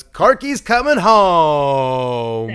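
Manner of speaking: drawn-out, rising holler; hyped announcement. Real, not acted. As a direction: belt it out like a ring announcer, stretching the last word.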